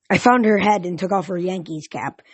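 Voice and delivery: ordinary delivery, nothing marked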